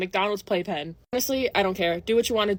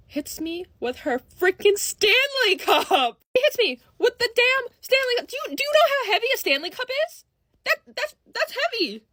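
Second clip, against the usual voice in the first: outraged disbelief; 11 semitones higher than usual. Retold or imitated. Vlogger retells being hit by a kid's cup, comically outraged. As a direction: stammer it out high, scandalised at the blow.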